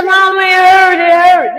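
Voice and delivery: high, loud mock wail of pain; loud, high-pitched pained cry; imitated screaming. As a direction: mimic a daughter hollering to her mama. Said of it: "she's screaming".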